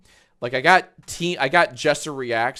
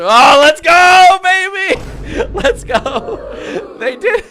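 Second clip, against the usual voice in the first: high, loud celebratory yelling; excited shout; 13 dB louder, slower than usual. Real, not acted. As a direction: scream with joy as it hits the goal. Channel hits a hundred subs.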